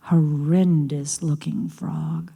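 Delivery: hushed, slow whisper. A narrator's reading. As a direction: breathe it out in disgust. Narrator whispers scary word.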